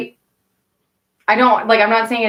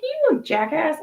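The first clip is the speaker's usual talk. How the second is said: incredulous; 8 semitones higher, 4 dB quieter, slower than usual. Retold or imitated. Reacting to his answer.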